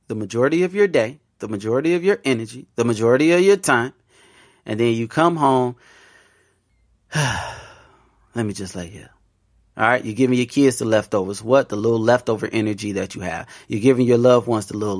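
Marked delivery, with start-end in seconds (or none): 5.8-9.1 slow, quiet, worn-out sigh; exhausted sigh. Acted. Worker home after a long day, slow and drained. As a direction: drag it out, too tired to move.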